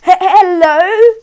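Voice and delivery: slow, loud, high hesitant call into the dark; nervous call. Acted. Mother calls into the room, nervous and slow. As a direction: call out, afraid of what answers. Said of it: "called the mother".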